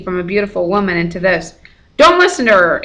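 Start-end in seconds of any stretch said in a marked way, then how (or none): 2.0-2.8 high, loud urgent call; shouted warning. A narrator's reading. Annabeth shouting to Percy from hiding, urgent. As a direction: call out across the garden. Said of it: "Annabeth shouted".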